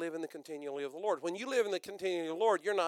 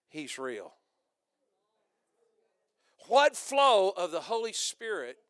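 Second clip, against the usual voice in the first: normal talking voice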